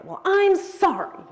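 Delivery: sarcastic apology. Shown as a demonstration. Showing the women how tone of voice twists an apology, sarcastic. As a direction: make the sorry sound like it isn't one. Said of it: "does that have the same meaning?".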